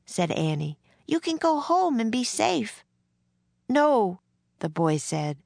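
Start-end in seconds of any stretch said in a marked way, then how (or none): 1.1-2.8 put-on high, girlish persuasion; pleading young girl. A narrator's reading. Annie urges John homeward, high and pleading. 3.7-4.2 put-on high, emphatic boy's protest; upset refusal. A narrator's reading. Drummer boy refusing to go home, upset. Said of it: "He sounded more and more upset".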